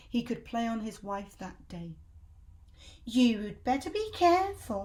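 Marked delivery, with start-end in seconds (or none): 3.0-4.8 loud, higher warning hag; Missus Twit voice. Acted. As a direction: warn him like a nasty crone. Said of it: "Missus Twit said".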